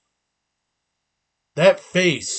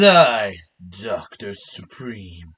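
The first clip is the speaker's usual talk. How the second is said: mad scientist; 7 semitones lower, much slower than usual. Acted. Introduces himself as villain persona.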